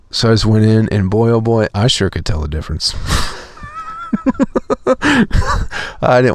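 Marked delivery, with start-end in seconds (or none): 3.0-6.0 high drawn-out laugh. Real, not acted. Laughs to viewers about skipping the gym, high laugh. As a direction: laugh it off at yourself. Laughing at his own story.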